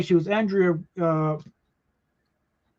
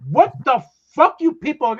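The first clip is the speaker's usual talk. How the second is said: exasperated; 7 semitones higher, 5 dB louder than usual. Retold or imitated. Exasperated producer quoted.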